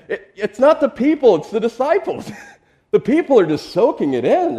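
cracking up mid-sentence; laughing. Real, not acted. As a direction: laugh out the punchline. Laughs at his own joke.

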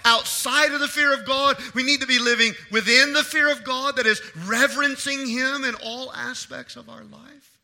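loud, high, driving sermon delivery; impassioned preaching. Real, not acted. Preacher urging church to fear God, impassioned preaching. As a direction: preach it with full force and weight.

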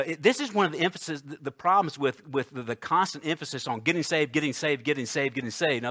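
usual speaking voice

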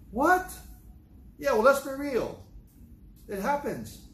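high, loud incredulous comeback; mock surprise. Mock reaction to listeners' surprise.

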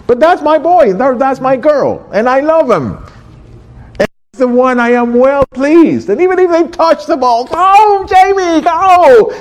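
high-pitched excited gushing, building to a loud cheer; cheering proud parent. Acted. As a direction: burst with pride, then yell the child on from the sidelines. Acts proud parent cheering child's game.

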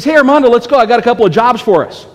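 imitating an eager pal; eager call-out. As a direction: call your buddy out, fast and keen. Eager friend calling to fetch his partner.

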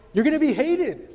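high, loud punch on "You're gonna be hated", stress on "be"; emphatic exclamation. Acted. Pastor jolts the church with a loud, high "You're gonna be hated". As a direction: hit "be" hard, almost shocked.

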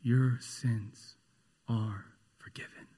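whispered words of forgiveness; gentle, authoritative. Retold or imitated. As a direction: hush it tenderly but with authority. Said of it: "in a gentle and authoritative voice".

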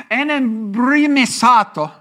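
enembrimisato drawn out slowly with Italian-sounding vowels; Greek word. Shown as a demonstration. Preacher attempting a Greek word, slow and careful. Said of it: "I'm sounding Italian, not Greek".

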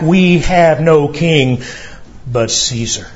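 usual speaking voice

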